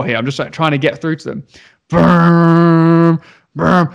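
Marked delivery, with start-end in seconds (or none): 1.9-3.9 vibrating buzz sound. Retold or imitated. IPad vibrating again.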